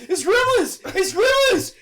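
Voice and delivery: high, loud alarmed shouting; hammed-up terrified warning; mock panic. Voicing a man warning his wife about gremlins, panicked shout.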